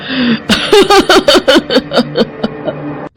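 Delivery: high, long witchy cackle; high-pitched ghostly laughter; evil cackle. Acted. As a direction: cackle madly, trailing away.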